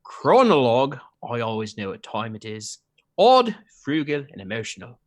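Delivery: mostly low Australian character voice; Australian accent. Acted. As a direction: list it off in a Cockney-tinged Australian accent. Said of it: "Just go Australian".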